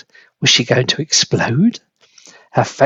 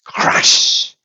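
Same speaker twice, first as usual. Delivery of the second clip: crash. A narrator's reading. Head smashes through ceiling.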